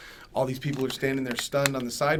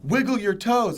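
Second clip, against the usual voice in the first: high, loud, urgent mock scream; imitated screaming; 10 semitones higher, 9 dB louder, slower than usual. Crying partner to injured man in hospital, imitated screaming. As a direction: re-enact a frantic partner screaming an order. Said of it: "she starts screaming at me".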